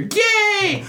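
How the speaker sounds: very high squeaky cheer; grunt cheer. Retold or imitated. Gamer imitates an exploding grunt, very high squeaky cheer. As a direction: squeak it like a tiny Halo grunt. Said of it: "you just hear".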